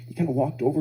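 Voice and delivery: ordinary talk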